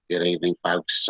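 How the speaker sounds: low, easygoing Australian pilot greeting; mock Australian captain on the intercom; Australian accent. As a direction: lay on an Aussie accent for the welcome. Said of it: "that's my Australian accent".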